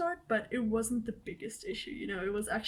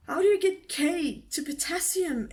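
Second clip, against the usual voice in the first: exasperated disbelief; 6 semitones higher, 5 dB louder than usual. Real, not acted. Exasperated about chemistry names.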